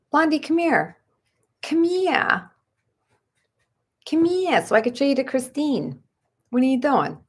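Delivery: high, coaxing pet talk; high sing-song call to the dog; baby talk. Retold or imitated. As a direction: coax the dog in a silly high voice. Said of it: "What are you talking like that for".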